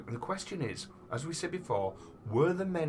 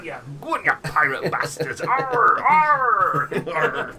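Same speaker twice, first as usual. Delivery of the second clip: loud hammed-up pirate growl; pirate shout; 8 semitones higher, 11 dB louder, slower than usual. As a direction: yell it like an angry pirate.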